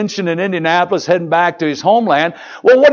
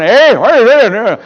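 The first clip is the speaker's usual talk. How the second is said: loud, raised "hey" imitating a listener; mimicked calling out; 4 semitones higher, 6 dB louder than usual. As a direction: imitate someone hollering from the back. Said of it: "She's going".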